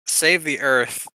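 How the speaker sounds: ordinary talk, nothing marked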